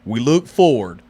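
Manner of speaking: low, slow announcer read; deep, drawn-out Texan ad voice; truck commercial voice. Retold or imitated. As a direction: sell it like a Ram truck ad. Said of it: "reading the whiskey bottles and I was doing the Hemi voice".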